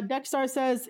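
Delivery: normal talking voice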